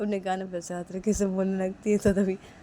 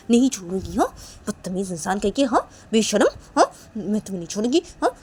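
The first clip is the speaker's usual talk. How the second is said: interrogating rant with sharply rising tag questions; Sneha's angry scolding; 6 dB louder than usual. Acted. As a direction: grill them, jabbing a questioning "huh" after each charge. (धमकाते हुए डाँटना)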